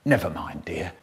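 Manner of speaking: mother consoling her son after a bad day; soothing mum. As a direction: hush the child gently. Mum comforts her boy.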